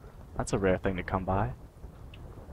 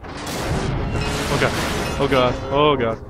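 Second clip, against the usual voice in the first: rising panicked oh god; fearful gasped oh god; panicking gamer; 5 semitones higher, 5 dB louder, much slower than usual. Real, not acted. Hiding from monster, panicking.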